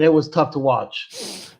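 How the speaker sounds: normal talking voice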